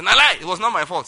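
loud protest of innocence; acted-out stubborn objection; defiant denial. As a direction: refuse to take the blame, voice jumping out. Acted refusal to the Holy Spirit.

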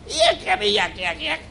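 very high, squealed chatter; evil spirit's yakking. Retold or imitated. Preacher mimicking the noisy evil spirit, high squealed yakking. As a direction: squeal out a demon's yak-yak racket. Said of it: "That's how he said it".

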